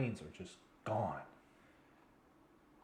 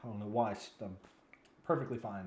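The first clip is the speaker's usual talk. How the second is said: ordinary talk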